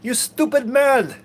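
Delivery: high, loud broken-English yell at barge traffic; imitating a tank commander yelling at barges in broken English. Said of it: "he had this broken English".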